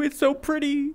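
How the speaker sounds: normal talking voice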